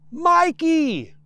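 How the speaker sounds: very loud, high shout of the name; boy bellowing up over the engine; roaring with all his might. Acted. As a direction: yell it with all your might. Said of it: "roars the boy with all his might".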